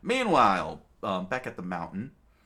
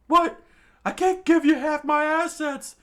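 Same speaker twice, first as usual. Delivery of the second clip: high, loud cry of disbelief; outraged shout; 13 dB louder than usual. A narrator's reading. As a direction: shout it in shocked protest. Shopkeeper outraged at demand.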